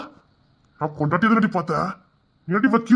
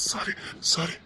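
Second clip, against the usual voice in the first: whispered sorry; 4 semitones lower, 12 dB quieter, slower than usual. Acted. Husband begs softly.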